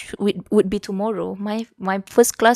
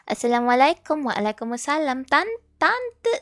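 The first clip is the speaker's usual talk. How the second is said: normal talking voice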